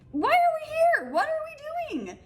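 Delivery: very high, squeaky mock outcry; exasperated disbelief. Reacting to a date who hates soda, exasperated. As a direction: pitch it way up, stretching the last words. Incredulous retort to soda hater.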